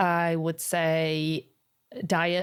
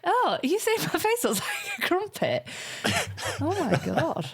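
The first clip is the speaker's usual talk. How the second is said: mock offended; 11 semitones higher than usual. Mock offence at crumpet face joke.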